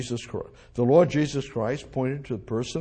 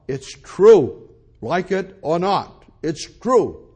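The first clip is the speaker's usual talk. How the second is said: rising, loud pulpit proclamation; full-force preaching; 4 semitones higher, 6 dB louder than usual. Acted. Preacher proclaiming to the congregation, loud and insistent. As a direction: hammer the truth home from the pulpit.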